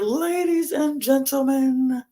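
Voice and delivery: slow, drawn-out announcer intro, pitched high; ring announcer call. Retold or imitated. Retelling the entrance, in a slow, high announcer call. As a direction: boom it out like the arena announcer. Calling out Paul Heyman's entrance.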